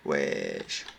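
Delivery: ordinary talk, nothing marked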